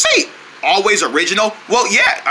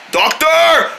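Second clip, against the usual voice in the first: calling out; 6 semitones higher than usual. Acted. Calling out for a doctor.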